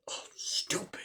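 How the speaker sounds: imitated whisper, under the breath; hushed, retold annoyance; whispered self-reproach. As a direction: hiss it softly to yourself. Sydney muttering to herself in retelling.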